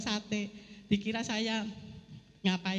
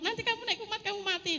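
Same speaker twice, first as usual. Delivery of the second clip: high-pitched imitation of alarmists; mock the doom-sayers in a raised pitch; mimicked warning; 10 semitones higher, faster than usual. Imitates everyone warning her she'll die. (menirukan peringatan orang dengan nada tinggi)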